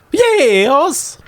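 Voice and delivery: high-pitched Gamorrean housewife; Gamorrean woman. Acted. Woman answering her door to strangers, high character voice. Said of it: "A middle-aged Gamorrean woman".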